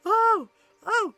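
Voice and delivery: high, loud mock gasps of alarm; hammed-up frightened horse; panicky horse. Horse comes upon a pile of sticks, mock panic. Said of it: "he's like".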